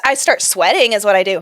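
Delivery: normal talking voice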